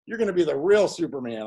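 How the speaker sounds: put-on calm the kid's worries cheerfully; retelling the director soothing the young actor; reassuring director. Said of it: "He goes".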